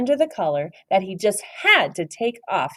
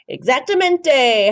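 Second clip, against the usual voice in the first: howled; 7 semitones higher, 6 dB louder, slower than usual. A narrator's reading. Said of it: "howled the doggies".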